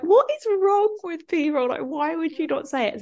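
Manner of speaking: high-pitched, baffled mini rant; mock despair at people, pitched right up; incredulous exasperation. Ranting to a friend about silent husbands, incredulous exasperation.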